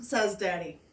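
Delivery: usual speaking voice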